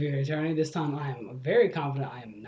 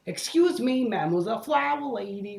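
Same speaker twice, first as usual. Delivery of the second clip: loud eager bird; 7 semitones higher, 5 dB louder than usual. Acted. Sparrowson blurts to flower seller.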